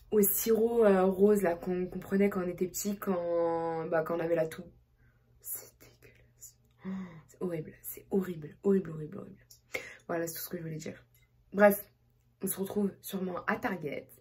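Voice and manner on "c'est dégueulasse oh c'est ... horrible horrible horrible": whispered disgust. Real, not acted. Vlogger whispering her verdict on kombucha, disgusted whisper. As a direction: hush it like a secret verdict.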